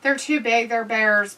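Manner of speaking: normal talking voice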